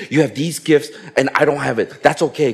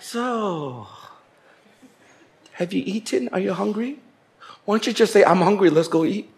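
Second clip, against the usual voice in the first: indirect hinting; 3 semitones higher, 6 dB quieter than usual. Retold or imitated. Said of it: "go around the circle and say".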